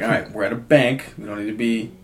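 quietly condescending bank teller; mildly patronizing, restrained; low-key condescending. Retold or imitated. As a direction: talk down to an angry customer, understated. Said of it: "low-key condescend".

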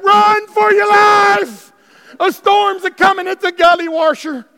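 loud, high, slow alarmed shouting; hammed-up frantic storm warning; panicked Elijah. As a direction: sound the alarm like a frantic prophet. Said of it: "Elijah goes berserk".

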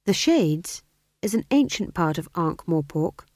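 ordinary delivery, nothing marked

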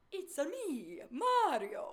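high, playful Mario voice; sing-song it up high like Mario; Mario impression. Asked whether they can do Mario, high Mario impression. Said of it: "Can you do Mario?".

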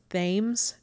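"Thames" with a soft th, as in "thin"; "Thames" starting with th, long a; American-style pronunciation. Shown as a demonstration. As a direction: say "Thames" as spelt, rhyming with "games". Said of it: "American me wants to pronounce this".

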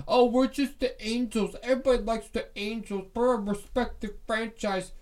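high, prissy mock voice; lightly voiced smug team bragging, mocking; smug mockery. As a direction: parody a self-satisfied franchise. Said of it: "and just act like that".

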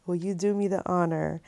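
soft, hushed imitation of proposing; tender retold proposal. Boyfriend proposing on one knee, softly tender. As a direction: pop the question quietly, retelling it.

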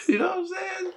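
high, breaking laugh; can barely get it out for laughing; laughing hard. Real, not acted. Telling the fight story, high and laughing.

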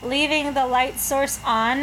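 usual speaking voice

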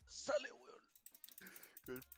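strangled, unvoiced "go away"; drawn-out, voiceless mock plea to leave; retold shooing away. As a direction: choke it out slowly, as if about to vomit. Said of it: "Y el weón me decía".

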